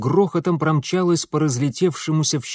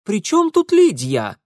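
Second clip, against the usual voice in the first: Ingvar voice; 8 semitones higher, 5 dB louder than usual. A narrator's reading. Ingvar asks.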